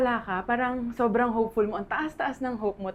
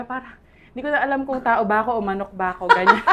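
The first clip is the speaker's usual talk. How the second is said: fast, rising into a big laugh, much louder; real laughter at her own story; 4 semitones higher, 10 dB louder, faster than usual. Real, not acted. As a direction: let the laugh take over at the end. Funny egg monitoring story.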